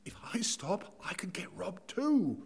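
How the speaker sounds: frightened whisper, glancing over the shoulder; a scared passer-by whispering; fearful whisper. Retold or imitated. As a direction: hush it like you're scared of being heard. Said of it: "to the fearful".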